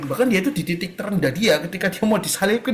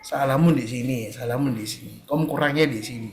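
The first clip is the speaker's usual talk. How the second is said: God answering gently; 7 semitones lower, 6 dB quieter than usual. Acted. God gently shows the listener their faults, low and soft. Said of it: "Jadi Tuhan pelan-pelan jawab".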